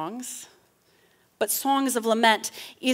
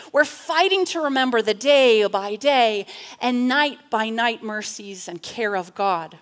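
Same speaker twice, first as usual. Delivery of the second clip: usual speaking voice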